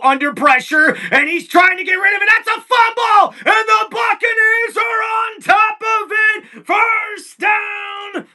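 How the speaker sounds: shouting the fumble call, rising high; excited play-by-play. Real, not acted. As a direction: erupt over the turnover. Fumble call, announcer erupts.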